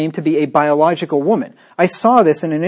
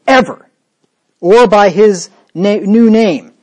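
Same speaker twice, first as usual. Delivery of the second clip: mock stern shout; 4 semitones higher, 6 dB louder, slower than usual. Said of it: "and he says".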